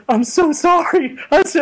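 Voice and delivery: very high, frantic apology; hammed-up grovelling to a child; mock pleading. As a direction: play a scared thug begging forgiveness. Thug apologizes to little girl.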